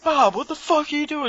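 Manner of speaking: high, exasperated mock yell at a friend. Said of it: "It's like".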